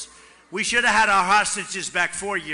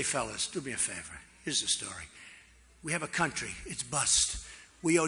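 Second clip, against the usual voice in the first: softened approach; 8 semitones lower, 8 dB quieter than usual. Retold or imitated. Softly reminding the Iran negotiators the hostages are back. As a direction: lower and softer, easygoing and friendly. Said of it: "So I'd go in differently now".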